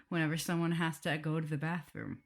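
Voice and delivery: usual speaking voice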